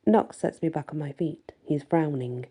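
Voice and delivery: ordinary delivery, nothing marked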